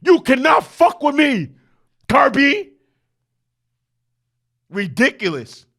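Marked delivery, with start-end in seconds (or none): 0.0-2.7 high, loud cocky taunt; mock Nick Saban. Coach Saban taunting Kirby Smart, cocky shout. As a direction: shout a swaggering boast at the loser. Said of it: "Nick Saban".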